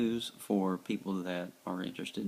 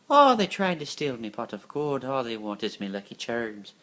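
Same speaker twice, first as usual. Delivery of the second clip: bad Irish leprechaun voice, louder; 3 semitones higher, 7 dB louder than usual. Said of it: "It, it's a bad version".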